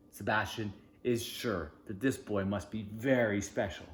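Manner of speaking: plain reading voice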